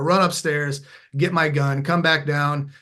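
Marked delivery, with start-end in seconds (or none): none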